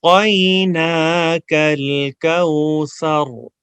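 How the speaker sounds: slow melodic chant with pauses; recitation to repeat. Shown as a demonstration. Teacher leads students to repeat after him, slow chant. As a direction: recite for the class to echo back. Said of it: "میں پاز لوں گا".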